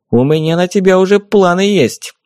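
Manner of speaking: lightly voiced little fairy. A narrator's reading.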